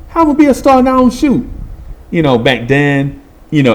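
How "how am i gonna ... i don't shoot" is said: high, fast disbelieving question; incredulous. Retold or imitated. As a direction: protest in disbelief. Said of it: "And I'm like".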